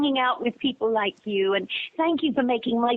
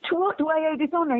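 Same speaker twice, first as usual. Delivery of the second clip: rattled off high and fast, playing herself; a playful high quick quote; quick mock posh reply; 8 semitones higher, much faster than usual. Retold phone call with director.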